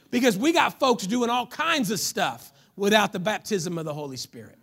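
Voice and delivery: ordinary talk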